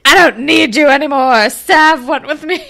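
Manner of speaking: high, loud hammed-up outburst; mock telling-off. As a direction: imitate a teen girl telling someone off. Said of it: "she's telling off Casey".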